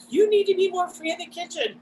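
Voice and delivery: high-pitched, emphatic imitation of an ex; Usman. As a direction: urge it high, accent not really attempted. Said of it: "I cannot do a Pakistani accent".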